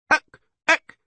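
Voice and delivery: very high, loud goat yelps; screaming goat. A narrator's reading. Goat horn leaps up and down, screaming. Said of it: "screamed the goat horn".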